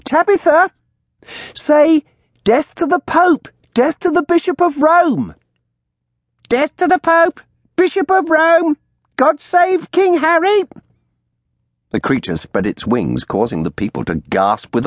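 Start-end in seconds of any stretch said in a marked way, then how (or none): 0.0-5.4 high, loud prompting; shrill crone command. A narrator's reading. Old woman prompting her bird, shrill. 6.5-10.6 very high, loud parrot squawk; talking bird. A narrator's reading. Bird echoing the old woman, shrill squawk.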